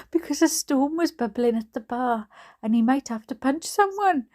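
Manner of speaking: high, loud, giggly reading; laughing while reading. Real, not acted. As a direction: push on reading through the giggles. Reader laughing as she reads on.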